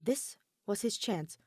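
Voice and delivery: ordinary delivery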